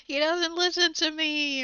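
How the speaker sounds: loud, high complaint; mock quarrel. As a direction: complain loudly, as if mid-argument. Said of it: "if you're fighting with someone".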